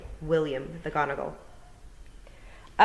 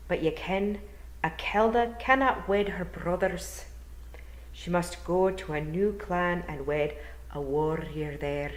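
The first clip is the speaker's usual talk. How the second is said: gentle Scottish burr, rolled r's; Scottish old Feagle; 2 semitones higher than usual. Acted. William explaining to Tiffany, courteous Scottish burr. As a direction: speak as a courteous Scottish elder.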